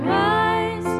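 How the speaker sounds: normal talking voice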